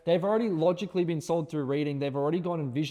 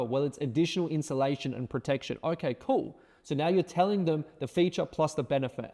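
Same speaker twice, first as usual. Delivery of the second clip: normal talking voice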